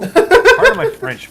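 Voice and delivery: very high, loud, slow prissy voice; high, loud, slow hammed-up pardon; mock posh apology. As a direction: put on a fussy posh aside. Mock polite aside.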